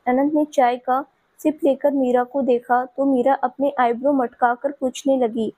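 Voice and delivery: plain reading voice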